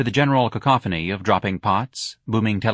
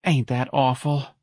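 slow, higher-pitched lament; sad; 5 semitones higher, slower than usual. A narrator's reading. Mrs Riley pities the fooled sisters, sad. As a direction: pity drawn out gently. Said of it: "Missus Riley said sadly".

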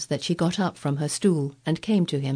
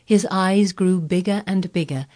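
ordinary delivery, nothing marked

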